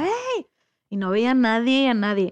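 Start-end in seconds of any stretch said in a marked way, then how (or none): 0.0-0.4 high, long call held back in volume; a restrained imitation of hollering for someone; mock yell. Swimmer calling out to a kayaker nobody can see, mock yell. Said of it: "le gritaba".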